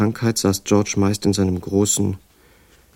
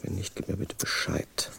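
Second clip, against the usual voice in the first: whispered echo; 13 dB quieter than usual. Acted. George mutters the letter along.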